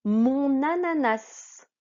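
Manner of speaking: "Mon ananas" slow, the n carried into "ananas"; n liaison. Shown as a demonstration. Teacher models "Mon ananas" to pupils, slow and careful. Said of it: "c'est le N qui va faire la liaison".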